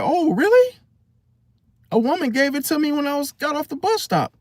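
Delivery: high, quick, innocent protest; high-pitched mock innocence, rushed; naive victim. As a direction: act the gullible one who got tricked. Retold victim excusing fake bill.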